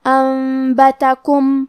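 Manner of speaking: انبتکم with the noon turned to meem, held nasally; iqlab with nasal ghunna. Shown as a demonstration. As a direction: slowly turn the noon of انبتکم into a humming meem. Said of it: "نون ساکن کو میم میں بدل کے اور غنہ کے ساتھ".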